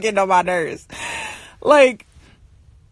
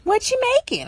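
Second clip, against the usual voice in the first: high-pitched nosy sister; lightly mocking high voice; imitating sister; 11 semitones higher, 4 dB quieter than usual. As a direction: mimic your sister asking, pitched way up. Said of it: "my sister come out".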